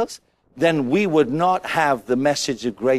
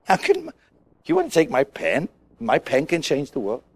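scoffing disbelief; 2 semitones lower, 3 dB quieter than usual. Retold or imitated. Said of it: "look at that and say".

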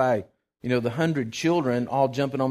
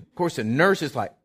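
ordinary talk, nothing marked

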